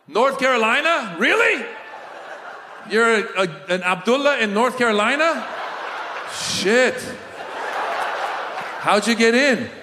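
high, incredulous comic host; crowd work callout. Acted. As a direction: call out to an audience member with mock disbelief. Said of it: "your crowd work involves calling out".

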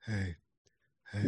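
low, soft come-on. Retold or imitated. Caller greets men on the chat line, in a low mock-Batman voice. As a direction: put on a deep, quiet phone-line persona.

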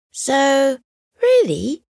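high, eager fox leaning in; hopeful fox. Acted. Fox about to offer to help the squirrel. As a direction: lift it up with hope.

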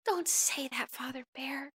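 very soft grieving plea; tearful whisper. Acted. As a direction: barely breathe his name. Grieving over dying toy.

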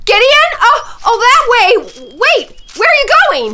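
loud high calls across a distance; urgent shouting. Acted. Calling to the chief which way Ray ran. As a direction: call out frantically.

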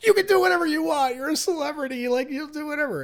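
high, rushed placating plea; imitating a flustered man grovelling to a celebrity; mock begging. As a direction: give in with squeaky, hammed-up desperation. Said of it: "begging him".

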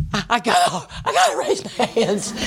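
can't hold it in, voice leaps up; bursting urge. Retold or imitated.

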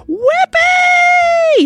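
long high joyful shout; yelling. A narrator's reading. Josie whooping as they speed up, high and loud. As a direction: whoop with delight at full voice. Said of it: "yelled Josie".

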